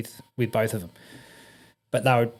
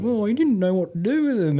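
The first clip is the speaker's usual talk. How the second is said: sheepish; 9 semitones higher than usual. Retold or imitated. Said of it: "very sheepishly".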